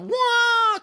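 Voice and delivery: a high, loud yelped "Wah!" like water splitting apart; mock sea-parting whoosh. Teasing friend mimics the sea splitting open with a whoosh. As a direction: burst out with a cartoon whoosh noise.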